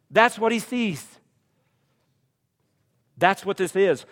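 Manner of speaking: fired-up preacher; preaching at full force. Acted. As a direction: drive it home to the congregation.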